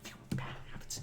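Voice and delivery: unvoiced disbelieving whisper; incredulous whisper. Agent incredulous at excuse.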